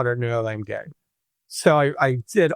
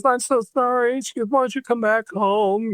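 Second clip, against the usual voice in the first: much higher, lightly tearful mother; a touch of a shivery apology; mother calling her son back home, high and lightly shivery; crying on the phone; 9 semitones higher than usual. Retold or imitated. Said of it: "She was crying on the phone".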